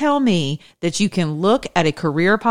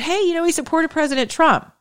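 sarcastic mock; 12 semitones higher than usual. Sarcastic voicing of a defense.

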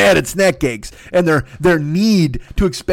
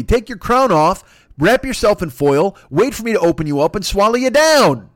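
ordinary talk

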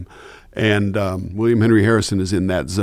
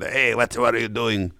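[name]; 3 dB quieter than usual. Shown as a demonstration. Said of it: "Or not that. That's like [name]".

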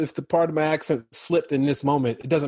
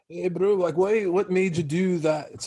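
higher, loosely attempted South African accent; bad South African accent; 5 semitones higher than usual. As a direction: imitate a South African director, badly. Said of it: "my accent's really bad for s-, South African accent".

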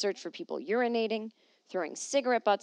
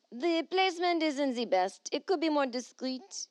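put-on higher, lightly French-accented read; mock French man; 7 semitones higher than usual. She reads a Paris man's quote in mock French.

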